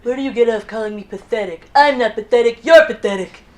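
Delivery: offended comeback, building to loud; mock indignant retort. Mock President mishearing empathetic, retorts.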